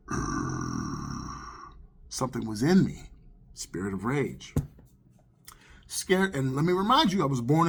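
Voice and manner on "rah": very deep, drawn-out throat growl; low growl. Retold or imitated. Man waking to hear his own voice, growling low. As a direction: imitate a sleeping man's rumbling growl of rage. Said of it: "I heard my voice going".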